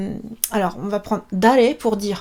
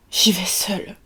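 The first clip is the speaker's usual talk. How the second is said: breathy dramatic whisper, lower and softer; 4 semitones lower, 4 dB quieter than usual. Acted. Teacher voicing a melodramatic sample answer in a whisper. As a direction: hush it like a tragic confession. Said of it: "toujours dramatique".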